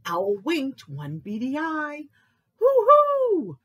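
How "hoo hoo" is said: high, loud hoot; long, ringing owl call. A narrator's reading. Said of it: "hooted Owl".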